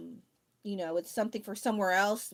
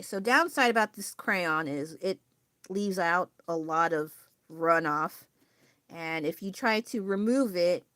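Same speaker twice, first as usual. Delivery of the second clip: ordinary talk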